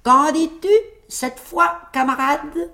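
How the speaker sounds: high-pitched gloating question; tailleur triomphant. A narrator's reading. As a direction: crow over the giant, voice way up. Tailleur triomphe devant le géant. (question triomphante, voix perchée)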